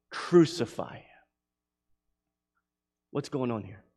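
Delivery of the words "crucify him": normal talking voice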